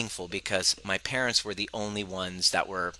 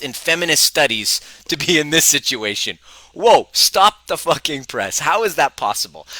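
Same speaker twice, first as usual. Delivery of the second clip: louder, higher mock outrage; hammed-up disbelief; 4 semitones higher, 7 dB louder than usual. Husband amazed at his feminist wife, hammed-up shock. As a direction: ham up the shock.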